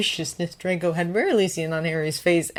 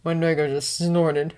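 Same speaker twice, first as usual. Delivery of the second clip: usual speaking voice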